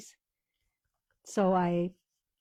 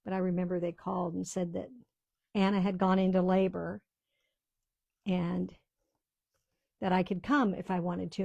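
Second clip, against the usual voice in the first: normal talking voice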